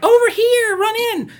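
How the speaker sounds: very high, loud squeaky call; mock kid calling out. Son calls the hunted stranger to the door, very high mock shout. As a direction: yell it like a little boy waving him in.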